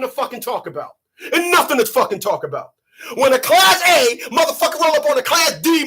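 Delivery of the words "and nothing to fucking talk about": ordinary talk, nothing marked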